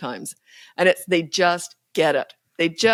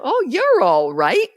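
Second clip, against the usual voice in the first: higher, slow, light reassurance; breezy reassurance; 6 semitones higher, 4 dB louder, slower than usual. Retold or imitated. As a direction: wave it off kindly.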